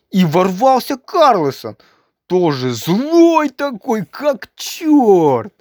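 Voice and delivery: ordinary delivery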